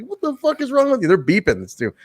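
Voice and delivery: high, fast angry outburst; mock angry. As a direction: snap back furiously. Siaki angrily yelling after slap.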